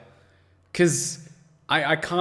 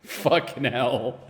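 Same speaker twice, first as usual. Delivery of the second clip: ordinary talk, nothing marked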